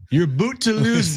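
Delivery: high-pitched hammed-up Canadian accent. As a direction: squeal it in an over-the-top Canadian accent. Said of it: "Would you do it in a Canadian accent".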